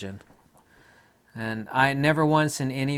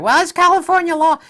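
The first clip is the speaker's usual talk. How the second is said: loud, much higher whiny mock voice; 11 dB louder than usual. Mimicking masked joggers in loud high mockery. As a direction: parody a prissy rule follower.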